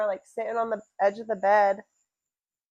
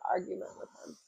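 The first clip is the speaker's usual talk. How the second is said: whispered aside; 2 semitones lower, 14 dB quieter, much faster than usual. Real, not acted. Trails into a whisper.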